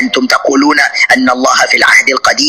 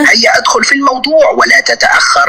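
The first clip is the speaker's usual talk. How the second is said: much higher, shouted order; impatient shout; 11 semitones higher than usual. Real, not acted. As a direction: bark at him to get on with it. Host impatiently orders opponent. (صراخ بنفاد صبر)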